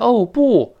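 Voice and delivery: high, loud mock "oh no"; mock literal translation. Shown as a demonstration. Teacher mocking a word-for-word translation, high exaggerated exclamation. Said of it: "不要翻译成".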